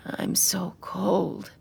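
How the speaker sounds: rough, whispered gravel voice; gravelly voice. A narrator's reading. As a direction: rasp it out, barely voiced. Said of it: "her voice like gravel".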